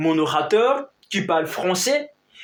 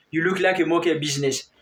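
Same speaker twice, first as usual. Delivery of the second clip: "You look like a monkey business" rattled off fast; quick repeat; 3 semitones lower, faster than usual. Shown as a demonstration. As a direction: toss the phrase off quickly this time. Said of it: "on dit".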